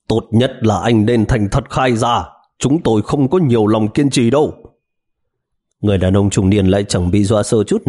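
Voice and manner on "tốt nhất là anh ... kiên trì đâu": grind out each word; through gritted teeth. Acted. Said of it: "cắn răng nghiến lợi nói".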